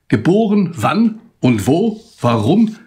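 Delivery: Geboren cried out high and loud, then wann und wo slowed; insistent questioning. Acted. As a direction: demand an answer, pressing each word. Insistent question quoted in poem. (eindringlich fragend, laut und hoch)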